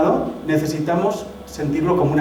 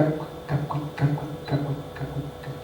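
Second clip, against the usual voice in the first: whispered rhythmic kuika, kuika; soft rhythmic whispered chant; 11 dB quieter, slower than usual. Shown as a demonstration. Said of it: "Suena así con un ritmo".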